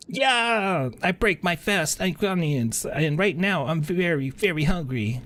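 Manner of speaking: high, silly cartoon voice; goofy hungry recruit. A recruit answering the pep talk, high and goofy. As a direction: play a dopey recruit starving for breakfast.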